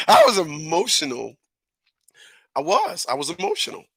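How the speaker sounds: hammed-up emotion, climbing high and faint; acted overemotional. Reenacting the fight with his brother, mock overwhelmed. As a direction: let it rise into a small high voice. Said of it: "let me act on it the right way".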